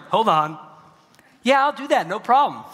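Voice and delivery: high, loud, mock-eager yes; chirpy agreeableness. Worker saving their job by agreeing, hammed-up chirpy eagerness.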